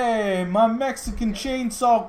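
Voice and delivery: hammed-up Cuban accent, about to crack up. Streamer attempts a requested Cuban accent, hammed-up and drawn out. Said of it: "Do a Cuban accent".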